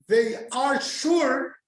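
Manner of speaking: slow, forceful preacher's emphasis on "they are sure"; emphatic declaration. Real, not acted. Preaching to the congregation about birds' trust, slow forceful emphasis.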